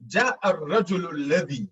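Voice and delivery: ordinary delivery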